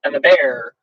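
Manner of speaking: lively, sing-song read-aloud of a rhyming book; storybook reading. A narrator's reading. Host reading a bedtime story to viewers, sing-song.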